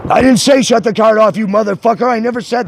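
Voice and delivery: loud, high angry outburst; angry yell. Real, not acted. Customer cursing at the bank agent, angry yell. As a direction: snap at the agent.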